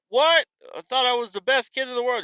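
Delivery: put-on high, indignant kid protest; whiny kid. Kid protesting bedtime to the parent, high and whiny. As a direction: squeak it out like a betrayed kid.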